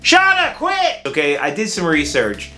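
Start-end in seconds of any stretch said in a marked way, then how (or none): 0.0-1.0 high, loud sudden yell; sharp yell. Real, not acted. Yelling at a noise in the room.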